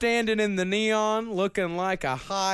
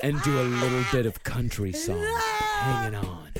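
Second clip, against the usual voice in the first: calm psychopath; 4 semitones lower than usual. Acted. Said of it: "do it in the serial killer voice".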